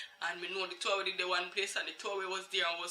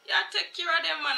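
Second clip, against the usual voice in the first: very high "What?" then scornful; mother throwing the lie back at her; mocking disbelief; 11 semitones higher, 3 dB louder than usual. Mother scoffing at the lady, high and sharp.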